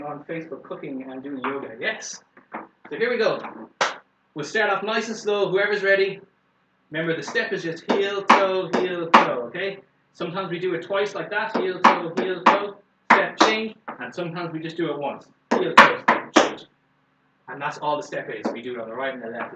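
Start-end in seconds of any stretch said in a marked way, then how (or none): 2.9-6.2 upbeat encouraging. Real, not acted. Teacher starting class. 6.9-16.6 calling dance steps. Shown as a demonstration. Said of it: "teacher calling steps".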